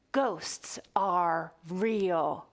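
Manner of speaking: very slow whispered reveal; telling kids a secret, slow spooky whisper. Acted. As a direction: whisper it like a ghost story. Dramatic reveal in story.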